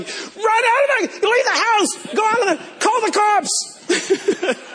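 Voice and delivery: high frantic shouting; angels shouting. Acted. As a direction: yell the warning up high. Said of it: "are all shouting".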